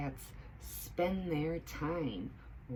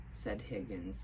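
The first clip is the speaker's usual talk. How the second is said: usual speaking voice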